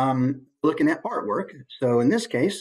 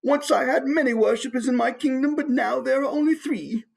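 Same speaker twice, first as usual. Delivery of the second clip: put-on high, wistful old monarch voice; mock-mournful, much higher royal voice; sad king; 10 semitones higher than usual. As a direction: lament a dwindled kingdom in a high voice. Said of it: "kind of sad, like".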